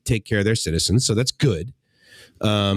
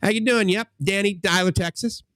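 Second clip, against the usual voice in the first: friendly Texan guy; 10 semitones higher than usual. Retold or imitated. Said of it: "I'll never forget this one guy".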